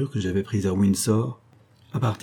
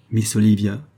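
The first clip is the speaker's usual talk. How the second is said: ordinary delivery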